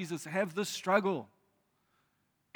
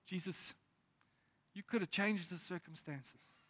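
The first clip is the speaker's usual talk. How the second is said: hopeful hush; 8 dB quieter than usual. Retold or imitated. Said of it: "a tiny glimmer of hope".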